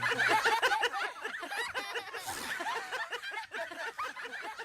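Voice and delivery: high, drawn-out fit of laughter; a long wheezing high laugh. Real, not acted. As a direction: crack up for a long while. Long laugh after joke.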